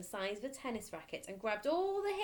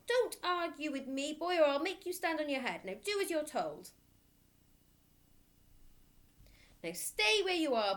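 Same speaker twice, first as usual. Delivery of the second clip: loud, higher barking threat; bullying headmistress; 8 semitones higher, 6 dB louder than usual. Acted. Headmistress threatening a boy, loud barking voice. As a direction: bellow it like a tyrant.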